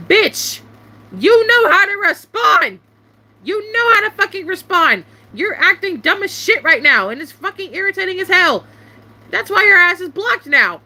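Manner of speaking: high-pitched, irritated outburst; angry telling-off. Retold or imitated. Streamer telling off Simp for acting dumb before blocking, angry. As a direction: hit each accusation high and exasperated.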